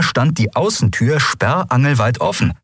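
ordinary delivery

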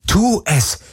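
high, urgent inner cry; inner scream. A narrator's reading. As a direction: scream at yourself to act. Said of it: "schrie alles in ihr".

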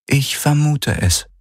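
measured, unhurried agreement; dry AI voice. Acted. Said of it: "sagte die Helix".

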